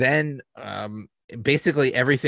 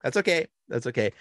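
forced calm; 6 semitones higher, faster than usual. Retold or imitated. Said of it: "he's like".

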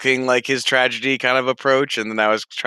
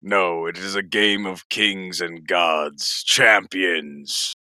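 low, measured voice of grand authority; emperor; 3 semitones lower than usual. Acted. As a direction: proclaim it like a solemn ruler. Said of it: "but you're the emperor".

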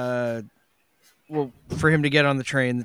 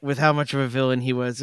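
ordinary talk

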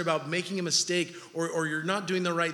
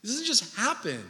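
normal talking voice

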